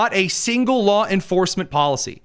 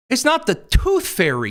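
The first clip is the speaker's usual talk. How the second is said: ordinary delivery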